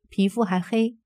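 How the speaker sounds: ordinary delivery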